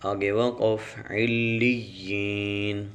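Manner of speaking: slow, measured tajweed recitation; Quran recitation. Shown as a demonstration. Teacher spelling Quran syllables for students, slow chant. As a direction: chant each syllable slowly and clearly.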